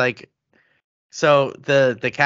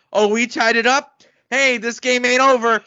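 high, loud hyped cheer; mock-excited co-host; 7 semitones higher, 5 dB louder than usual. Imitating co-host cheering in Discord, loud and hyped. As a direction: ham up a fan's renewed hope. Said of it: "And then Josh was like".

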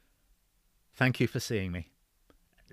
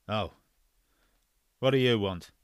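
gruff; 6 dB louder than usual. Acted. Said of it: "his voice asked gruffly".

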